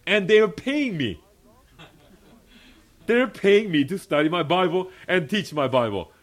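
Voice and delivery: loud, high-pitched excited preaching; delighted amazement. Real, not acted. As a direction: marvel out loud at your good fortune. Delighted at being paid to study.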